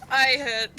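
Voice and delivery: loud burst of laughter through the words; laughing. Real, not acted. Player laughing at GM's slip.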